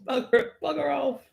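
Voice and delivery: hammed-up British accent; mock British. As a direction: shoo them off like a cranky Brit. Mock British shouting match.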